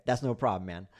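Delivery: normal talking voice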